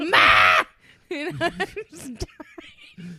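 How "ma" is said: imitated holler for mother; loud mock yell; yelling out the door. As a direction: bellow it out the doorway. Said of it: "was yelling at her mom".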